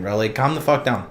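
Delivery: normal talking voice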